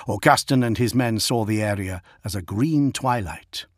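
ordinary delivery

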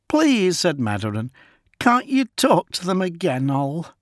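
put-on high pleading young woman. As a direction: beg him, pitched high. Mother pleads.

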